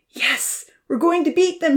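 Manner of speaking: high, excited shouted cheer; yelling. A narrator's reading. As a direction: whoop as the cart takes off. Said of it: "she yelled".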